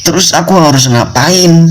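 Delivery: high, pleading cry of a cornered student; desperate student. Retold or imitated. Student begging his friend what to do, high desperate plea. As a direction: ask it high and helpless, at wit's end. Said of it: "kata si mahasiswa ini".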